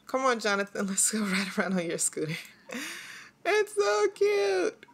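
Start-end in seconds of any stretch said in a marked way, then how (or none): 3.5-4.8 very high, drawn-out squeal; adoring coo. Real, not acted. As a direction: coo slowly over something adorable. Gushing over child on scooter.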